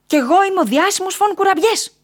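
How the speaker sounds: very high, loud squealed boast; piercing, self-important outburst; eager interjection. A narrator's reading. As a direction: burst in, announcing yourself at the top of your pitch. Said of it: "πετάχτηκε ο Κουραμπιές".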